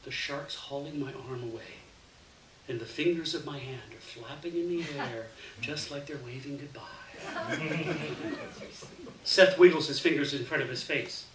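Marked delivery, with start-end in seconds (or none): 0.0-6.8 softer, hushed telling of the gory bit; hushed storytelling. Acted. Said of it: "lower my voice to a whisper". 6.9-9.2 breathy laugh. Real, not acted. Laughing over the story.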